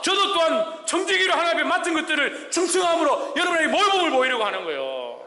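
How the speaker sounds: fervent shouted testimony; impassioned preaching shout. Real, not acted. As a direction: cry it out from the pulpit. Preaching climax, pleading for example.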